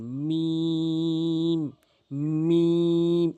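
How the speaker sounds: "মিম" held very long on a level note; demonstrated mim. Shown as a demonstration. Teacher shows learners the letter, "মিম" long and sustained. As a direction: stretch "মিম" out slowly, lips meeting. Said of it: "এভাবে উচ্চারণ করবেন".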